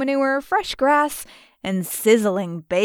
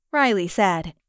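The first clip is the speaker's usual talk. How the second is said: usual speaking voice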